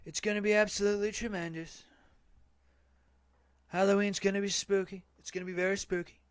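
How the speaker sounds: pitched high and loud, a hammed-up rally boast; Trump-like boast. Boasting to chat about Halloween like a hammed-up politician. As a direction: brag like a showman at a podium.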